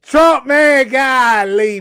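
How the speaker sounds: loud, high frustrated cry; exasperated outburst. Real, not acted. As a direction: blurt out the name in frustration. Venting about Trump's broken promise.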